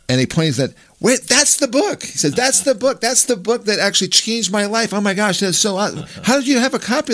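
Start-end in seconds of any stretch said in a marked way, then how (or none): 1.0-2.0 high, slow, astonished exclamation; retelling a stunned stranger, pitched way up; excited recognition. Retold or imitated. Retold man recognizing the book. 2.3-7.1 high, thrilled outpouring; overjoyed stranger, retold high and eager; excited gushing. Retold man thrilled by the book.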